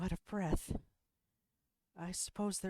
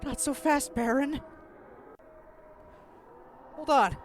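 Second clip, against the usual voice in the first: put-on high, loud girlish call; girl character calling; 11 semitones higher, 10 dB louder than usual. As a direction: shout after him as you run. Character calls out while fleeing.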